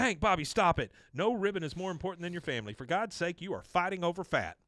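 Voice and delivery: exasperated scolding. Acted. Peggy to husband and son fighting over food, scolding. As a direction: break up the squabble with a high, insistent voice.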